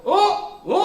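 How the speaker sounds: high, chummy calls; teacher casually hailing students instead of nagging; cheerful teacher greeting. Retold or imitated. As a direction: greet the boys like a buddy. Teacher greets kids again.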